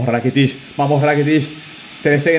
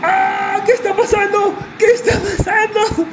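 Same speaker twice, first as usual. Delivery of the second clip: wrestling commentator. Real, not acted. Wild reactions to goals in game.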